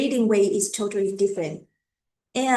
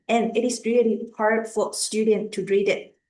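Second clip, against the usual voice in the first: normal talking voice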